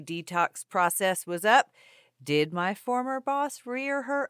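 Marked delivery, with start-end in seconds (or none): 2.2-4.3 hammed-up cliffhanger questions; mock-dramatic teaser. Host teasing next week's revenge story, hammed-up suspense. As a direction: draw out the suspense, mock-ominous.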